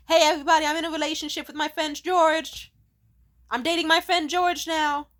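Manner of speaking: high, loud bubbly announcement; mock announcement. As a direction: proclaim the news to everyone. Quoting his blog announcement.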